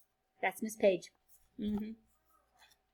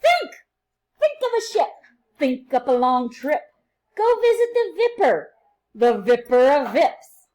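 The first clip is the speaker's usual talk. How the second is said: bright, sing-song read-aloud for little ones; reading to children; 2 semitones higher, 11 dB louder, slower than usual. A narrator's reading. Teacher reading a rhyming book to her class, sing-song and lively.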